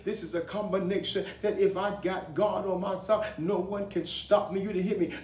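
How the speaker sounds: impassioned preacher in full flow; fervent preaching. Real, not acted. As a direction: exhort the congregation in punched, repeated phrases.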